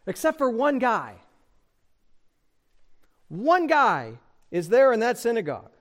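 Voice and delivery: ordinary delivery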